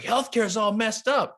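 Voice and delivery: high, slow mock rant about healthcare; emphatic complaint. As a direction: play it up as a dinner table know-it-all. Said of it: "where I'm like".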